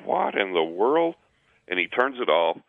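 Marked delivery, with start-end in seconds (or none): 0.0-1.1 slow, higher-pitched puzzlement; retold bafflement. Gas man reacts to his screaming meter, retold bafflement. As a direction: draw it out, baffled.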